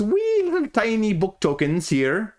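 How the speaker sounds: a passing, light Scottish lilt on the tiny tokens; Scottish accent. Pretend. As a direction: slip briefly into a Scottish accent. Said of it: "Don't know why I did the accent there".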